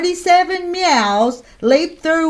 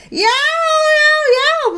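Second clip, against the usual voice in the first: very high yelping wail; wailing yow; much slower than usual. Acted. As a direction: yelp from a bitten finger. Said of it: "Missus McSnoot wailed".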